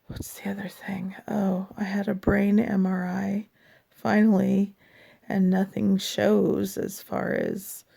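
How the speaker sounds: ordinary talk, nothing marked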